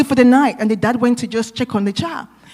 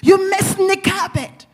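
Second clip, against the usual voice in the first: high-pitched scolding, drawn out at first; reenacted fatherly telling-off, pitched high; retold angry yell; 8 semitones higher than usual. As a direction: imitate a father yelling at his child. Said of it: "I yelled at you again".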